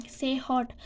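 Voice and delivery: ordinary talk, nothing marked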